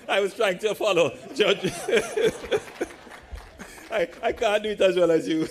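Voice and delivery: words bubbling through real laughter; laughing. Real, not acted. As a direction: laugh it out, loud and amused. Laughing at his own joke.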